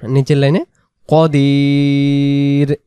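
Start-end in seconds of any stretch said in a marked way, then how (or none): 1.1-2.8 কদির with a heavy short ক, not কা. Shown as a demonstration. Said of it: "কাদির পড়া যাবে না". (কদির, কাদির নয়)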